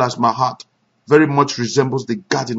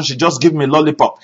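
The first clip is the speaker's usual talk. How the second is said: ordinary talk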